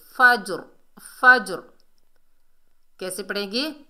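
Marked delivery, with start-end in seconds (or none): 0.1-1.6 फज्र loud, bounced j and full rounded r; heavy ra. Shown as a demonstration. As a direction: hit फज्र firmly, stopping on a thick r. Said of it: "रा को पुर पढ़ेंगे".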